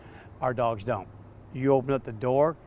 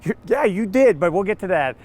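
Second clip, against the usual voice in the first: flustered reply; 7 semitones higher, 10 dB louder than usual. Retold or imitated. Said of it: "I go".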